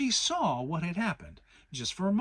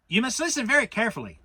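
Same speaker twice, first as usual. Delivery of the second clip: fast, louder and higher; hurried Rabbit; 9 semitones higher, 9 dB louder, much faster than usual. Acted. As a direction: rush it out as bossy Rabbit. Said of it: "said Rabbit".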